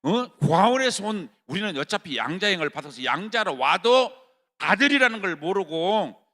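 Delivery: loud, high sermon cry; fervent shouted preaching; full-force preaching. Acted. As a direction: preach it hard to the congregation.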